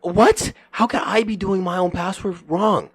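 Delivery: high, indignant retold protest; outraged disbelief. Acts out outrage at coworker's accusation.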